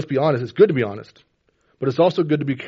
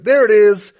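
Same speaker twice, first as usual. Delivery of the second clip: loud, high sudden proclamation; mock voice of God; 10 semitones higher, 11 dB louder, slower than usual. Preacher mimicking God instantly fixing everything, loud mock proclamation. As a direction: announce it like an instant miracle. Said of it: "God's like".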